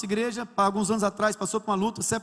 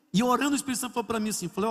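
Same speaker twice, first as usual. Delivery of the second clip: usual speaking voice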